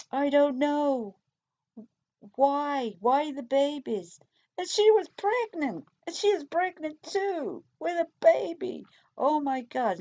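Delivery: high imitated crying voice, slowing on the babies; imitating crying. Suspect breaking down to the detective, high imitated crying. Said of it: "she's crying and she's breaking down".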